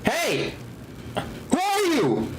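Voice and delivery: ordinary talk, nothing marked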